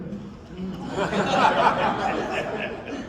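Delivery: a real laugh that rises, amused at his own story; real hearty laugh. Real, not acted. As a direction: let out a genuine amused laugh.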